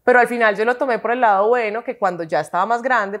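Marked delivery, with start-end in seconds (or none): none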